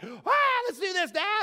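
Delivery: high, loud mock yell of eagerness; overexcited outburst. Excitable son charging in to his dad, hammed-up excited yell. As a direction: burst in high-pitched, imitating your excitable self. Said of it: "in the context of my passion".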